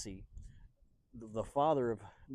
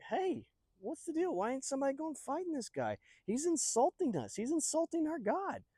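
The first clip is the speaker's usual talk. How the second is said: indignant protest; 13 semitones higher than usual. Acted. David objects to insults to God, indignant. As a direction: protest in a strained high pitch.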